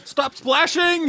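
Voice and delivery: drawn-out high shout; slow high yell. Pretend. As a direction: stretch the yell out slowly. Mock rowing shout.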